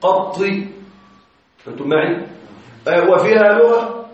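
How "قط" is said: "قط" with a-vowel, doubled emphatic t, final i; doubled ta with kasra. Shown as a demonstration. Teacher showing students a variant form, "قط" pronounced qatti. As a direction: say "قط" as qatti, ending in i. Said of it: "بفتح القاف وتشديد الطاء مع الكسر".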